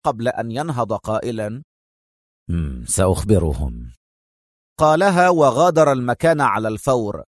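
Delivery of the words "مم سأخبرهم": low, contained grudging assent; suppressed anger. A narrator's reading. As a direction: agree in a low voice, holding anger back. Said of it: "في غضب مكبوت". (بغضب مكبوت)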